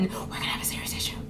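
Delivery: fast, hushed mock warning; acted threat under the breath; whispered threat. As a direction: drop to a menacing whisper. Threat to mom over power button.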